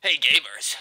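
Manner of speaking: loud, fake-friendly mascot greeting; playful game character, projected loudly; Sonic the Hedgehog. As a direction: hail them as an off cartoon hero. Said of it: "trying to sound like Sonic the Hedgehog".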